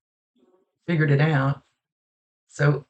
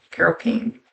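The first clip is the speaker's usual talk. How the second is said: unsure question; 4 semitones higher than usual. Shown as a demonstration. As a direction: check the name, unsure of it. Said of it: "Is that the right name?".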